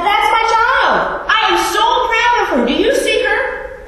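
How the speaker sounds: high, loud, drawn-out boast; God's proud declaration. Acted. God boasting of the newly baptized child, loud and proud. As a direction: crow with pride about your child to everyone. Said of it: "the declaration by God".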